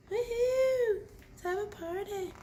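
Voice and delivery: high excited woohoo; high-pitched party cheer; party whoop. Acted. Party voice for friends.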